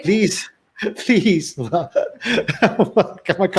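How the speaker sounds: giggling through the words; cracking up, can't stay serious; laughing. Real, not acted. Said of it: "trying to say this with a straight face".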